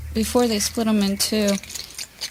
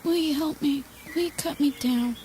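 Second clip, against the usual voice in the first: normal talking voice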